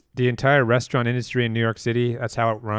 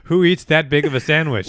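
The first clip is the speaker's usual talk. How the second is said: higher, louder pretend telling-off; mock family scolding; 5 semitones higher, 6 dB louder than usual. Imagined family back home scolding the worker, in mock outrage. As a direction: play an exasperated relative berating him. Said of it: "his family is like".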